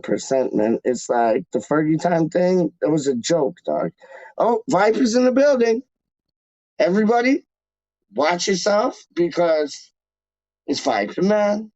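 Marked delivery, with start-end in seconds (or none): 4.4-11.7 hype announcement. Real, not acted. Streamer hyping a chat regular's arrival, excited announcer call. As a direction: announce him like a big entrance.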